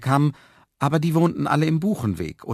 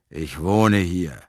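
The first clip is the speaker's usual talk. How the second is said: very slow, low man's voice; drawn-out, low declaration; fat man; 5 semitones lower, 4 dB louder, much slower than usual. A narrator's reading. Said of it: "erklärte der Mann". (dicker Mann, tief und gedehnt)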